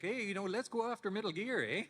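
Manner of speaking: high-pitched, loud Canadian accent, eh at the end; old Canadian accent. Shown as a demonstration. Showing the young Canadian accent he had before voiceover. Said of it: "I used to speak with a Canadian accent".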